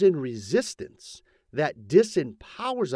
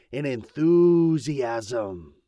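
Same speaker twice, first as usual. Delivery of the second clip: ENTHUSIASM stretched out very slowly; emphatic enthusiasm; 2 semitones lower, 4 dB louder, much slower than usual. Real, not acted. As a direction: draw out the word to fire up listeners. Urging belief with enthusiasm.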